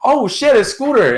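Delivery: high, surprised exclaim; mock surprise. Narrator recognises his late friend, high and surprised. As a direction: feign the sudden recognition. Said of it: "And I'm like".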